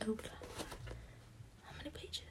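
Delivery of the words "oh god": ordinary talk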